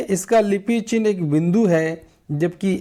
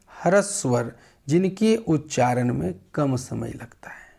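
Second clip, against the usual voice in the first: whisper; 3 dB quieter than usual. Shown as a demonstration. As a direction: breathe the definition out without voice. Teacher whispers definition.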